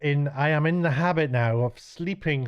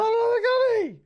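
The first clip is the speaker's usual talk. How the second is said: very high and a bit louder; high falsetto; 5 dB louder than usual. Shown as a demonstration. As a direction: squeal it in falsetto. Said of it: "That last line was".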